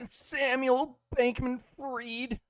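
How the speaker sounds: high, slow merchant voice; sly mysterious shopkeeper. Acted. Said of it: "Your merchant voice needs some fucking work".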